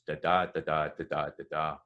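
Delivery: even "da da", every second da stressed; poetry beat. Shown as a demonstration. Teacher parodying verse rhythm, da da in regular beats. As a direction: chant da da in strict regular alternation. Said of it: "the way they do in poetry".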